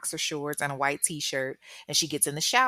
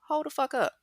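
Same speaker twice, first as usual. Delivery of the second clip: much higher, sudden sharp turn; snapping; 10 semitones higher than usual. Retold or imitated. Captor abruptly turns on girlfriend, snapping. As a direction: cut her off hard. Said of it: "switched up again".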